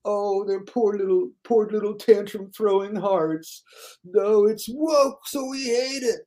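high, slow whiny mock voice; sarcastic high whimper, slow; mocking delicate critics. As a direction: pout it out like a fragile crybaby. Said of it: "They're so delicate".

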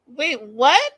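high, loud disbelief; stunned by what she heard; shocked. Real, not acted. Shocked reaction to Colt.

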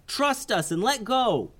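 high youthful voice calling up; yelled advice. Acted. Isaiah calling up to Kavi: high earnest yell. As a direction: shout wise words up to a friend. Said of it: "yelled Isaiah".

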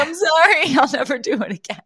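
high, fast mock-sobbing apology; hammed-up little boy begging forgiveness; crying child. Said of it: "falls at her feet crying".

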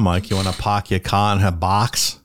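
put-on joking Boston accent with dropped R's; mock Boston accent. Host teasing Mike about Boston Mom, mock Boston accent. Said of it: "That Boston Mom".

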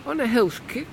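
amused woman's jab; Hortense, teasing. Acted. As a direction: needle him about his sweating.

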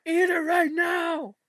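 high, loud, drawn-out order; hammed-up shouted demand; yelled command. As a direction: imitate a mother yelling at her kid. Retold mother ordering crying child.

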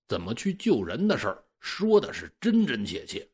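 ordinary delivery

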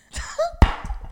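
breathy high squeal of laughter. Real, not acted. Laughing at bowling ick.

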